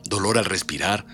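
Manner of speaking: dark, dramatic storyteller voice; horror story narration. Acted. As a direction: read it like a grim ghost tale. Reads horror story aloud.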